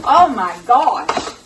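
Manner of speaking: exasperated. Real, not acted. Food processor malfunction spills onion.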